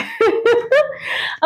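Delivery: a bright high giggle. Real, not acted. Girl giggles after finishing her song. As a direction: giggle at herself, pleased.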